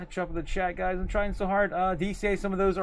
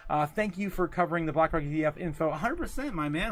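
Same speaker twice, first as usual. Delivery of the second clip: normal talking voice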